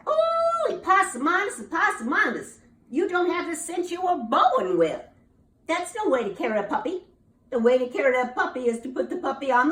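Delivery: high, loud exasperated mother; a fed-up mama scolding her foolish son, loud and high. Acted. Mama scolding her son for carrying cake wrong, exasperated and high.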